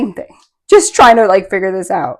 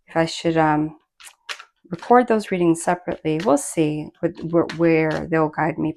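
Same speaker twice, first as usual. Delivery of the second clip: ordinary delivery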